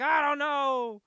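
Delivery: high, loud hammed-up whine; mock teen wail. Daughter answering her mom, high loud mock wail. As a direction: wail it like an exaggerated upset daughter.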